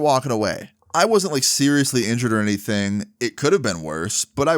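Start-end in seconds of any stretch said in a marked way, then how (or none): none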